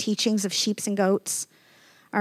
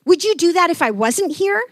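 angry challenge; 9 semitones higher, 6 dB louder than usual. Retold or imitated. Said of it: "so angry".

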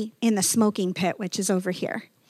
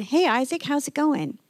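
higher, cheerful hey Isaac; friendly greeting; 6 semitones higher than usual. Retold or imitated. Pastor greeting Isaac in the smoking pit, cheerful and higher. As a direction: greet him brightly, like a friend. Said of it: "and I said".

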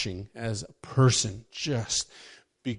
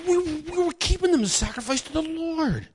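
very high, whiny excuse voice; excusing Saul. Retold or imitated. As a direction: squeak out a lame excuse. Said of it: "And Saul explains".

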